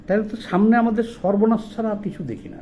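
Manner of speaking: ordinary talk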